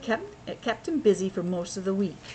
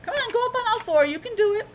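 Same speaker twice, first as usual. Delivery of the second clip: coaxing; 12 semitones higher, faster than usual. Real, not acted. Parent coaxing baby to crawl, high and fast. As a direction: lift the voice way up to coax the little one.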